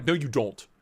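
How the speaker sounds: ordinary delivery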